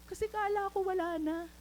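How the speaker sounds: high voice imitating a tearful friend. Friend explaining the tears to speaker, high tearful retelling. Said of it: "Tapos umiiyak siya".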